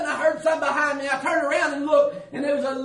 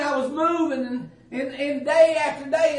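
usual speaking voice

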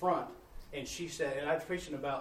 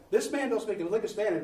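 higher, louder playful complaint; teasing; 6 semitones higher, 7 dB louder than usual. Retold or imitated. Brother Paco teasing the stranger about the preacher, higher and louder. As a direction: rib him good-naturedly.

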